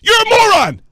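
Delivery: host loses it on the caller; furious shout. Real, not acted. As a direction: rage at the caller. Host screaming insults at caller.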